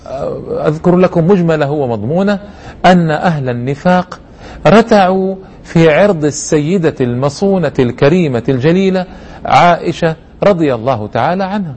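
ordinary delivery

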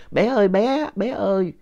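put-on high, far-off old woman's call; imitating grandma calling from her room. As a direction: call out for the child like a frail grandmother. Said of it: "tiếng ngoại con la ới ới".

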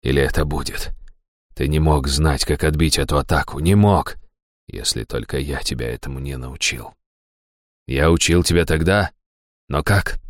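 low, hushed bewildered musing; stunned. A narrator's reading. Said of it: "ошеломлённо сказал он". (тихое потрясённое недоумение)

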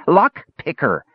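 "Lock picker" pitched high, both words punched; insistent kender stressing "Lock picker"; emphatic. A narrator's reading. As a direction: jab out each word of "Lock picker". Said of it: "into the ground for emphasis".

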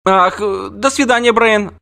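put-on high child's voice, hurried farewell; flustered girl. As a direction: blurt the goodbye like a startled child. Ciri saying goodbye.

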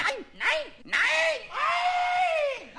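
rising squealed no, peaking high; high shouted no; shrill refusal. Acted.

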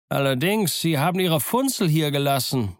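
high, carrying answer; called down. A narrator's reading. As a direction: call the discovery down to the street. Man at window calls down.

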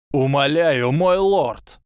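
loud high desperate cry; desperate begging. A narrator's reading. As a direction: plead with everything left. Quirrell begs his master.

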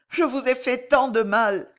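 pleading Alice. A narrator's reading. Alice begs Jeanne to accept her gift, pleading. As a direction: urge her to accept, lightly voiced.

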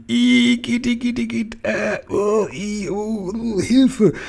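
very high squeal of disgust; hammed-up high-pitched revulsion; retching shriek. A narrator's reading. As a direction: squeal and gag, crying for help. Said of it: "Sofort schrie und würgte sie".

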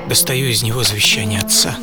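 ordinary talk, nothing marked

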